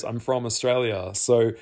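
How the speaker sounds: ordinary delivery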